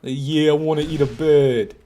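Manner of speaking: mock New Zealand accent, lightly done; a playful stab at a New Zealand accent. Streamer doing a New Zealand sentence for chat, mock New Zealand accent. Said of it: "Give me a sentence somebody who lives in New Zealand would say".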